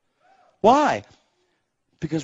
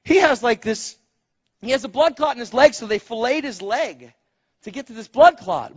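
ordinary delivery

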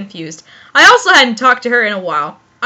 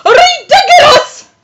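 very high yelled exclamation on the edge of laughter; high-pitched incredulous yell; shouted; 11 semitones higher, 4 dB louder than usual. Shown as a demonstration. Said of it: "That's level shout".

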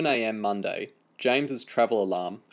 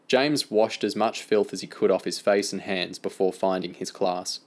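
plain reading voice

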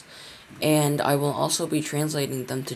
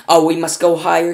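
loud, much louder eager Wonka; excited cry; 3 semitones higher, 11 dB louder than usual. A narrator's reading. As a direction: burst out with manic glee.

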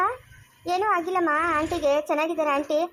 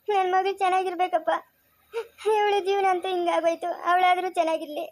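sobbing plea, voice pitched up with tears; crying mother. Acted. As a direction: beg tearfully for the daughter's marriage. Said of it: "ಅಮ್ಮ ಅಳ್ಬೇಡ". (ಅಳುತ್ತಾ ಬೇಡಿಕೊಳ್ಳುವ ತಾಯಿ)

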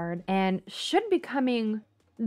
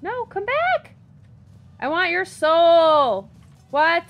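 much higher and louder frantic call; high yell after fleeing dragon; 8 semitones higher, 10 dB louder, slower than usual. Real, not acted. Player yells at the dragon flying off, high and loud. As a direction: shout after it, desperate for its soul. Dragon flies away mid-fight.

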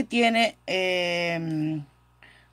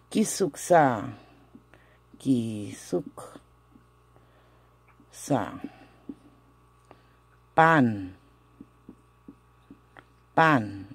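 drawn out in pieces, with long pauses; slow pronunciation demo; 5 semitones lower, much slower than usual. Shown as a demonstration. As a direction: dictate it slowly, piece by piece. Teacher sounds out new question. (pronunciación lenta, con pausas largas)

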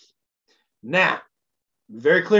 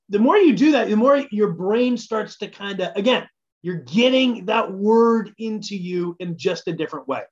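normal talking voice

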